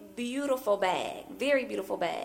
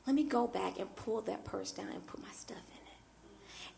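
whispered resolve; confiding it softly, as if to oneself; hushed inner thought; 3 semitones lower, 10 dB quieter than usual. Retold or imitated. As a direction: drop to a near-voiceless whisper. Private resolve retold as a thought.

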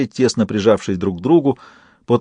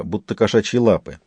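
ordinary delivery, nothing marked

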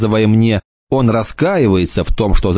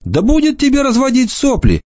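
mutter. Acted. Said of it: "пробормотал Енах".

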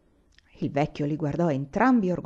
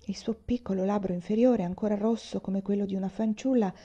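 plain reading voice

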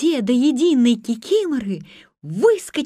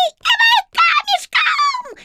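very high laughing squeal; squealing swamp sprites; 4 dB louder than usual. A narrator's reading. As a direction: shriek it with glee. Кикиморы кричат в ответ. (кикиморы визжат со смехом)

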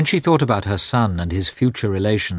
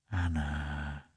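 a soft, reproachful murmur, much softer; reproachful tenderness; 3 semitones lower, 14 dB quieter than usual. A narrator's reading. Vronsky softly reproaching Anna, in a tender murmur. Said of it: "he murmured with reproachful tenderness".

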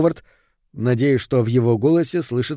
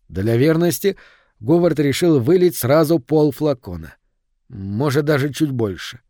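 ordinary delivery

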